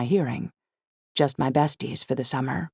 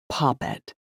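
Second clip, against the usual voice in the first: flat Poppet. A narrator's reading. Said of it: "when a term of endearment isn't delivered with any actual affection".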